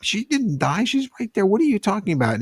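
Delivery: high, indignant disbelief; imitating an angry wife's protest; mock angry protest. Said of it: "she got all mad at me".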